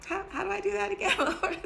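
half-laughing disbelief at the Lord; laughing plea. Retold or imitated. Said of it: "I was like".

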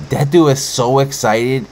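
usual speaking voice

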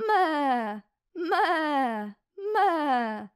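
high wavering bleat; goat bleating. Acted. Reading the poem to kids, a high wavering goat bleat. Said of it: "Koza meczy".

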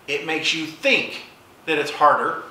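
normal talking voice

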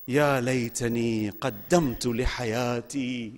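low, soft, measured Quranic chant; Quran recitation. Acted. Recites Quran verses on Judgement.

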